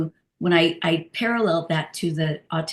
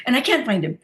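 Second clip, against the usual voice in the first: ordinary delivery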